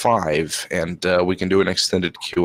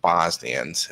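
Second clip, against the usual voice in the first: ordinary talk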